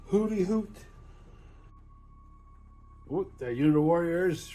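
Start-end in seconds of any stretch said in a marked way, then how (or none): 0.1-0.8 light mock owl call; silly owl sound; playful owl hoot. Owl units moving.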